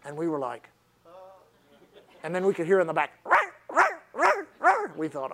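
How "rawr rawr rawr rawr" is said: high yappy mock growl; dog growl imitation. Imitating the dog barking from its kennel. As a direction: bark like the little Lab in the crate.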